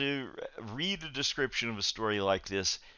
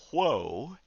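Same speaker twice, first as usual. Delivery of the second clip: mild mock call, higher and swooping; retold yell; 5 semitones higher than usual. Boys out riding the horse, mock yell. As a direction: play at halting a horse without really shouting. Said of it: "would yell".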